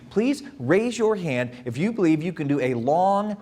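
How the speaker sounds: ordinary talk, nothing marked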